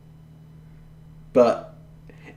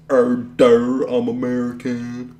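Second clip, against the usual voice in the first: slow, dopey mock American voice; dopey American impression; 4 dB louder, slower than usual. Mocking how all Americans sound to him, slow dopey voice. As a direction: drag out a silly American drawl. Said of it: "when I hear them talk, is just".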